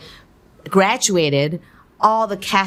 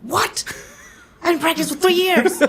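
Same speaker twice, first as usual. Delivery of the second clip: high-pitched, laughing disbelief; verbijsterd protest; 12 semitones higher than usual. Retold or imitated. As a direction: squeal your mock outrage through a laugh. Retells her shocked reaction, laughing.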